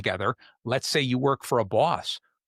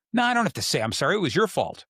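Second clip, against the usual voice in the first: quick, higher-pitched comeback; mock defensive refusal; 6 semitones higher than usual. Stranger who bumped into you refusing to apologize, mock indignant. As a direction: play a stranger throwing the blame back, fast.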